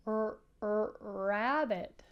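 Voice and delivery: "er, er, rabbit" with a stretched R onset; sounding out the first letter; R emphasis. Shown as a demonstration. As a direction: lean on the R before "rabbit". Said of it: "Can you do it?".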